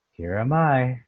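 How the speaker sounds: tone. Shown as a demonstration. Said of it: "do you hear the tone?".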